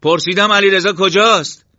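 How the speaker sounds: full shouted demand, very high; loud, high, frantic shout. Acted. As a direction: yell the question, demanding an answer. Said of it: "صدایش را بالا برد".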